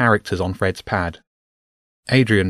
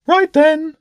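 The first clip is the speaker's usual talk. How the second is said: high, loud pigeon voice; bishop bird; 6 dB louder than usual. Acted. Bishop bird readies for take-off, high bird voice.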